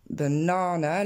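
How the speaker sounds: BANANA with a long open middle "aa"; long English A. Shown as a demonstration. Teacher on a pronunciation course, long-vowelled English banana. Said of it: "c'est le son A".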